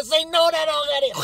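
very high, loud cut-in; mock shrieked interruption of a rambling speaker; impatient orientation leader. Orientation leader cuts off nervous librarian, shouting impatiently.

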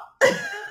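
squealing burst of laughter; high laugh. Real, not acted. She laughs at the insult.

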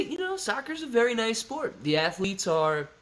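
put-on high sing-song compliment; mock diplomatic soccer praise; mock polite praise. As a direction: say it like a polite fake fan. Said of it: "just say".

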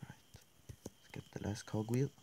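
soft whispered plan. Real, not acted. Player sneaking for the cogwheel, whisper. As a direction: whisper to stay hidden.

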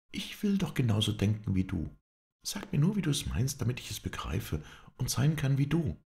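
begging him to explain himself; Scarlett pleading. Acted. Scarlett pleads with Ashley, longing to understand him.

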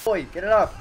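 frantic yell, get it off; panicked shout. Real, not acted. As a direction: scream it in panic. Something attacks him.

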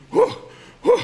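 short, sharp high "hoo" bursts; two separate puffs of sound, acted; imitated gasps. Doctor's patient in resuscitation, imitated sharp gasps. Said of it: "כבר עושה ככה".

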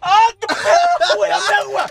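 high yelling for water; pained shout. Real, not acted. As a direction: shout in real pain. Host burned by pepper.

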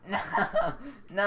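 laughing no, no. Real, not acted. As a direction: laugh through the words. Teammate laughing after missing knife.

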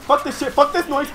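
ordinary talk, nothing marked

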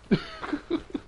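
squeaky burst of laughter; high-pitched giggle. Real, not acted. High laugh after the bit.

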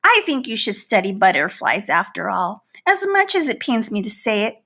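plain reading voice

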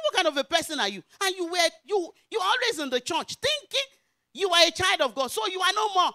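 loud, high-pitched rebuke; outraged scolding. Retold or imitated. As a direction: scold her with rising indignation. Pastor scolds woman in retold confrontation.

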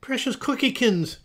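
high, loud, gushing cry. A narrator's reading. Mother bursting in to her fallen daughter, gushing cry. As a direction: fuss over a darling child in alarm. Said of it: "she cried".

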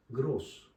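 gross said with a long drawn o. Shown as a demonstration. Teacher shows the long vowel in gross. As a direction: stretch the o in gross. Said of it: "Uzun okunuyor".

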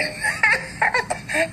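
sharp high laugh close to crying; high bitter laugh. Acted. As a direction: burst into a pained high cackle. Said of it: "I laugh so hard".

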